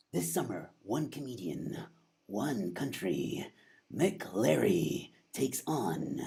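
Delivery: slow, hushed trailer narrator; drawn out with heavy dramatic pauses; movie-trailer voice. Pretend. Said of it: "I love doing this voice".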